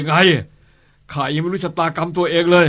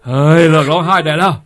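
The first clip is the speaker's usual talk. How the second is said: scolding; 2 semitones higher, 8 dB louder than usual. Acted. Boss scolds the crying wife.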